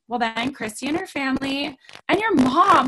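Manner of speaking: ordinary talk, nothing marked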